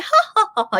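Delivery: high, loud mock stage laugh; awkward cheesy laugh. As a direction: imitate actors' cheesy, awkward ha-ha. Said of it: "they had this very awkward laugh".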